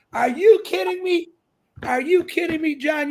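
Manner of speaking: high, emphatic disbelief; incredulous. Real, not acted. Host answering John's fear of disappointing, high incredulous protest. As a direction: toss the worry aside as absurd. Host rejects guest's modesty.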